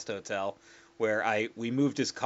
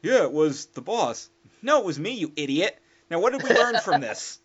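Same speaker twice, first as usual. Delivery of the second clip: louder, teasing reveal; mock gloating; 6 semitones higher, 9 dB louder than usual. Teller revealing the prank to the coworker, louder and teasing. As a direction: tease him, louder.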